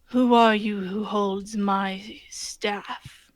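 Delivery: slow, low, hollow voice of Death; deathly hollow voice. Retold or imitated. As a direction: drag each word out like the Grim Reaper. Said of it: "he says in his deathly hollow voice".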